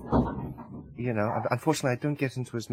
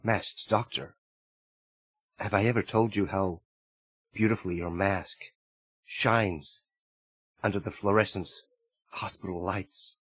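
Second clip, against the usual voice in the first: tender confession; 7 semitones lower, 5 dB quieter than usual. Acted. Romantic confession.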